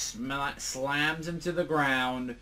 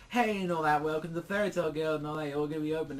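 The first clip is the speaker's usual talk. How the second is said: mock cheery welcome, starting high; welcoming greeting; 5 semitones higher, faster than usual. Guild members hailing the new recruits, welcoming. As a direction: greet newcomers with a lifted, lively call.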